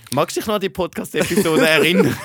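real laugh breaking into the question. Real, not acted. Reminding the co-host's girlfriend of the episode, laughing.

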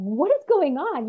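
loud, high, drawn-out demand; retold yell. Girlfriend yelling at police, slow loud demand. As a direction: yell it at the officers, slowly. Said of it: "so I start yelling".

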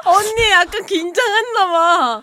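laughing. Real, not acted. Laughing at nervous friend.

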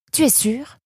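high, questioning mother's voice; doubting mother. A narrator's reading. Mother checking that her son is sure, doubtful. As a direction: press the question, pitched well up.

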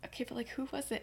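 very soft, secretive question; imitated hushed nagging; hushed prying. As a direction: ask under your breath so others can't hear. Secretive asking at breakfast.

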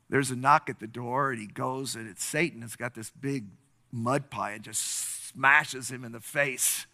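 ordinary talk, nothing marked

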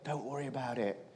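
very soft, low, reassuring voice; quiet reassurance. Retold or imitated. Conductor to boy without money, quietly reassuring. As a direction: reassure gently, almost under your breath.

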